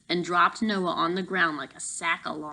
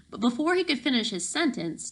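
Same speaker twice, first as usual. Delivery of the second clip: ordinary delivery, nothing marked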